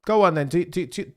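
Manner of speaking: egging the clip on; sudden burst of eagerness; excited. Real, not acted. Excited reaction to a new clip.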